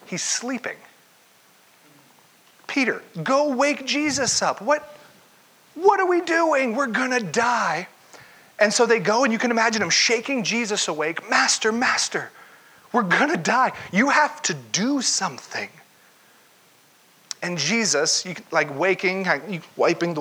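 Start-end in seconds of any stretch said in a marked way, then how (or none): 2.7-7.9 panicked plea. Retold or imitated. Frightened disciples in the storm plead, panicked. 11.3-15.7 desperate plea. Retold or imitated. Disciples shaking Jesus awake.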